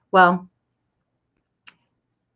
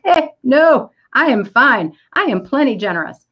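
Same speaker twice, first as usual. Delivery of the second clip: defensive brush-off; 6 semitones higher, 3 dB louder than usual. Retold or imitated. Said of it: "And I'm like".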